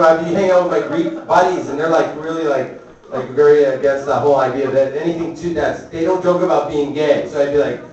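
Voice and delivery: usual speaking voice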